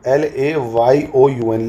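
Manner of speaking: very slow, letter-by-letter spelling; dictating LAYOUN to the class. Shown as a demonstration. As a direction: spell it out slowly so students can write it. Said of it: "teacher spells the capital name".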